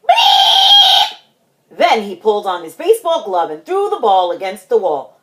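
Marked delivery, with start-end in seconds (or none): 0.1-1.2 loud, very high squealed honk; piercingly high horn imitation; saxophone blast. A narrator's reading. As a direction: shriek it like a blown horn.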